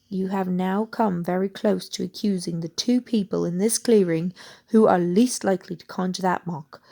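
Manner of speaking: ordinary delivery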